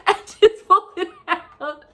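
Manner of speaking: quoting sister, breaking into laughter; laughing instructions. Retold or imitated. Retelling sister's advice, laughing the whole way. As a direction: giggle through the instructions. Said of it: "she's like".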